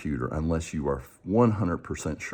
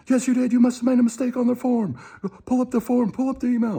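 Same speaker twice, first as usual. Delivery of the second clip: much higher, mock insistent caller voice; pushy scammer; 4 dB louder than usual. Scammer orders the caller to pull up the form, high mock voice. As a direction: lay on the fake outrage, high-pitched.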